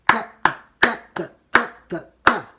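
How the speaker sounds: every "ta" hit loud and strong; counting eighth notes aloud with accents; accent on each beat. Shown as a demonstration. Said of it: "j'ai mis un accent fort sur chaque temps".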